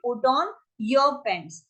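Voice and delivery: drawn-out teaching delivery; slow demo phrase; slow model phrase. Shown as a demonstration. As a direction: stretch it so learners can follow. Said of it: "क्या लिखोगे".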